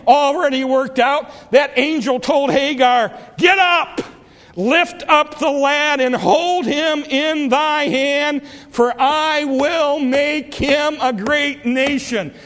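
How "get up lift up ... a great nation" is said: angel's command. Acted. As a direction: command her to get up, ringing. Angel commanding Hagar voiced.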